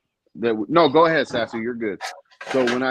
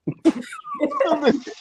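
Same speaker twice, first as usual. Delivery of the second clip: high giggle. Real, not acted. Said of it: "You can't be giggling like that".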